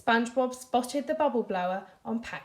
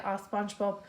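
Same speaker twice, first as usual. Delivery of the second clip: plain reading voice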